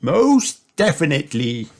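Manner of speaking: Grandpa Pig. A narrator's reading. Grandpa agreeing to spooky things, lightly voiced and emphatic. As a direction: add it with emphatic relish. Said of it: "added Grandpa".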